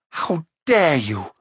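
slow, indignant challenge; outraged cry. A narrator's reading. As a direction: draw it out in outrage. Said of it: "cried Eilonwy".

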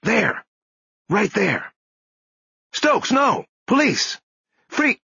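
high-pitched cop shouts; urgent shouting. A narrator's reading. Police shouting from the hallway at Stokes, urgent high shouts. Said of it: "he heard shouts".

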